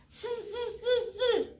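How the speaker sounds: high buzzing zzz monkey noise; playful high zzz chatter for the children. Acted. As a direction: buzz back like cheeky monkeys.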